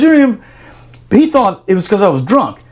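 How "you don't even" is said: high, loud disbelief; exasperated outburst. Retold or imitated. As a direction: blurt it out in disbelief. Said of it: "he goes".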